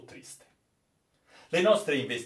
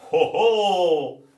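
loud, higher captain's surprised oh oh; surprised exclamation; 8 semitones higher, 10 dB louder than usual. Acted. The captain reacts to the sailor's doubt, loudly surprised. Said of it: "esclamò il capitano".

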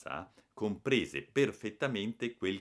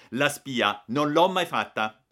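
haughty; 8 semitones higher, 9 dB louder, slower than usual. Acted. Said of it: "lo interruppi con alterezza".

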